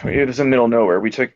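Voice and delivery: ordinary delivery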